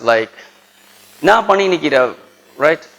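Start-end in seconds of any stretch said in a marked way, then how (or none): 1.2-2.1 higher-pitched Chennai street Tamil; demonstrating Chennai Tamil slang; Chennai dialect. Shown as a demonstration. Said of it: "Chennai dialect".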